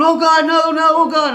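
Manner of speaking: loud, high re-enacted panic; panicked cry. Driver who thinks he just killed somebody, panicked cry. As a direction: cry out in dread, reliving the moment. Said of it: "I mean, I'm panicked".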